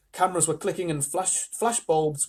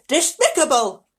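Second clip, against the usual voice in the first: high, loud disgust; high-pitched grandmotherly indignation; outraged grandmother; 12 semitones higher, 8 dB louder, slower than usual. Acted. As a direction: condemn it loudly, pitched way up. Grandmother condemns the girl.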